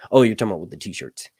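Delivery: ordinary delivery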